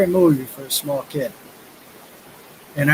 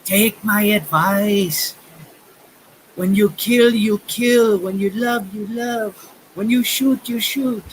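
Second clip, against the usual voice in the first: put-on lightly done German accent, higher and slow; a hint of a German villain's accent, drawn out; 5 semitones higher, slower than usual. German-accented villain warning Bruce Willis, slow and higher. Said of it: "speaking in a German accent".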